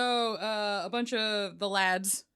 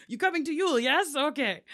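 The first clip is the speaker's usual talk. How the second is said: mock cheery Viking; 7 semitones higher, 5 dB louder than usual. Viking invites cousin to Yule.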